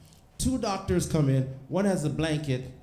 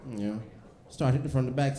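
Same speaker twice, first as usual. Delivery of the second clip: ordinary talk, nothing marked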